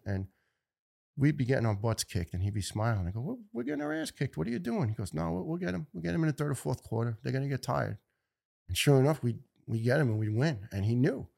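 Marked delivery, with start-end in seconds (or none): 3.2-4.9 high, pleading mock complaint; exasperated. To John mid-game while losing, exasperated. 5.1-8.0 high, quick cheerful assurance; confident friend. Retold or imitated. John reassures his teammate they'll win, upbeat.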